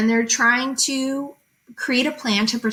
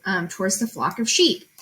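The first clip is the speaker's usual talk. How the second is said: ordinary talk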